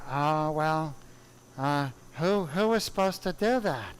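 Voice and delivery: lightly voiced old surgeon asking a question; Doctor Roten impression. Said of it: "hilarious impression".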